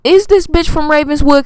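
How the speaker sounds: high, loud suspicious question; alarmed disbelief. Retold or imitated. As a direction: gasp it out as if it clicked. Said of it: "And I'm like".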